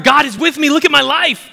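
high, loud mock boast; showy boast. Acts out boastful believer.

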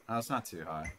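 ordinary talk, nothing marked

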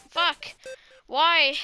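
ordinary talk

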